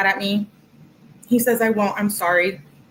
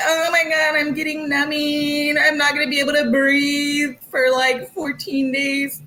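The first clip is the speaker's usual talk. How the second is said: higher, hammed-up dramatic girl voice, slowing at the end; mock whiny girlfriend; 6 semitones higher than usual. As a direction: imitate her panicking over numbing, overdone. Mocking girlfriend's dentist texts.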